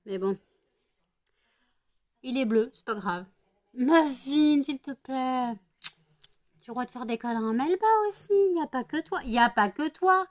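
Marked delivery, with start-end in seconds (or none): 3.7-10.3 pleading scold. Real, not acted. Scolding Muffin to share cuddles.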